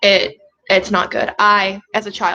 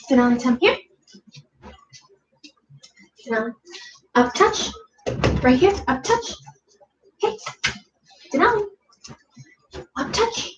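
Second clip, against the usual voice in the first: talking to a dog; 3 semitones higher than usual. Real, not acted. Cueing dog.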